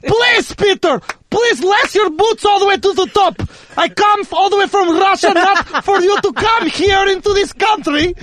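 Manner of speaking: put-on scolding Russian father, lightly accented; Russian dad accent. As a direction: lecture him like a proud Russian dad. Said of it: "continues Russian dad bit".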